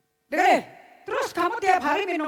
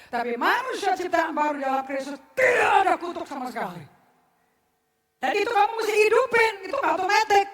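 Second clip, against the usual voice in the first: louder, driving sermon voice; fiery preaching; 3 semitones higher, 6 dB louder than usual. Acted. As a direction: preach hard at the congregation. Preaching at full force. (khotbah berapi-api dan lantang)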